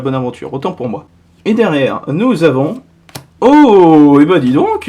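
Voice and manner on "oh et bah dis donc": loud, drawn-out "oh" of surprise; impressed surprise. Real, not acted. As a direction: react loudly to a great find. Découvre une belle carte. (surprise admirative)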